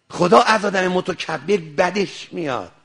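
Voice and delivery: ordinary talk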